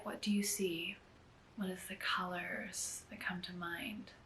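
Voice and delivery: unhurried, hushed meditation lead; guided meditation. Acted. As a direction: gently guide them inward, leaving space between phrases. Leads a guided gratitude meditation.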